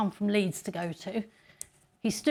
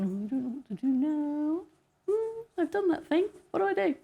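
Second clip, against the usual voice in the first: high, plaintive mock helplessness; lost new starter; 6 semitones higher than usual. New starter pestering busy colleagues, high mock helplessness. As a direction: whine it high like a clueless newcomer.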